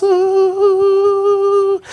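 long, high sustained "ooh"; acted gleeful hoot, held on one high note; victory whoop. Golfer doing a little victory dance, gleeful whooping. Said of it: "He does a little victory dance".

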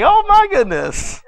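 very high, slow oh my goodness; exasperated. Retold or imitated. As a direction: throw up your hands in disbelief. Speaker's own exasperated reaction.

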